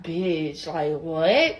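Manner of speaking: low, soft disbelief; understated, low-pitched "say what"; casual bitch. Retold or imitated. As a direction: drop it low and quiet, puzzled. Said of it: "So I was like".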